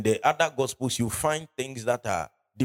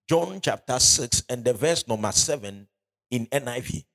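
ordinary talk

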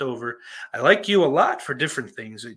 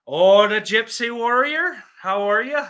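lightly put-on Irish accent, higher and louder; 9 semitones higher, 8 dB louder than usual. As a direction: greet a viewer in a playful Irish accent. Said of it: "I just do the Irish accent for you".

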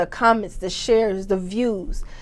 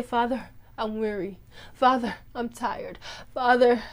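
softer, tired confession to Father; weary prayer; 7 dB quieter than usual. Pretend. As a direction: sigh it out to God, worn down. Modelling honest prayer of tiredness.

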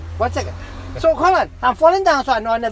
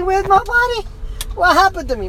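high, distressed cry at half volume; retold scream; 7 semitones higher than usual. Man recounts finding no body, retold scream. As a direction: relive a panicked scream without full force. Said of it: "Man, I'm screaming".

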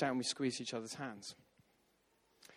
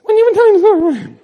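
much higher and much louder, strangled squeak; squeaky panicked version; 20 dB louder than usual. Shown as a demonstration. To the vicar, how it actually came out, high squeak. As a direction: blurt it out in a nervous squeal. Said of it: "I think it came out like this".

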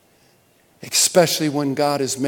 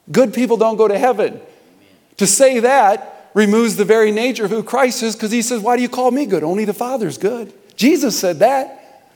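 fiery preaching; 6 semitones higher, 4 dB louder than usual. Acted. Preaching with rising force.

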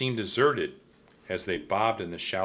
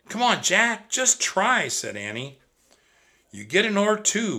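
put-on high, loud pleading girl; eager little sister; 11 semitones higher, 6 dB louder than usual. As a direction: coax her brother, bright and insistent. Annie pleading, voiced high.

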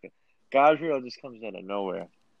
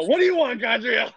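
high, loud mock-exasperated outburst; 11 semitones higher, 4 dB louder than usual. Storyteller answering his pestering drunk friend, exasperated. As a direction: snap back at a pestering friend, hammed up.

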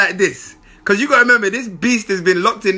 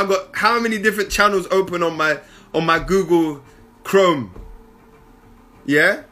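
ordinary talk

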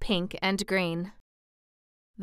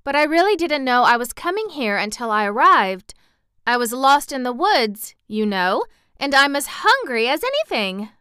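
put-on higher, louder child's voice; earnest little girl, pleading; 5 semitones higher, 5 dB louder than usual. Lost girl explaining herself to townspeople, earnest and high.

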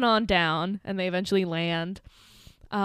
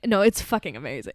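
normal talking voice

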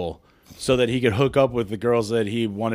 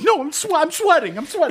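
high, choked fake sob; hammed-up weepy voice; mock tearful denial. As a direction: insist you're only sweating while crying. Denying crying while tearful.

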